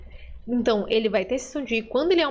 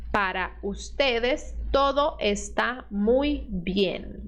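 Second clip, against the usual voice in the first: each word of Para ustedes todo está muy bien drawn out slowly; slow dictation pace; slower; much slower than usual. Shown as a demonstration. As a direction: read it slowly for the students. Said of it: "vamos mais devagar".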